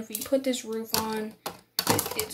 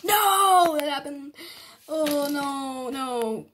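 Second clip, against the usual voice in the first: startled no; 3 semitones higher, much slower than usual. Real, not acted. The build breaks on camera.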